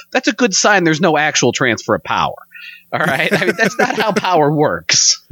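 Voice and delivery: ordinary talk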